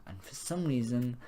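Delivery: ordinary talk, nothing marked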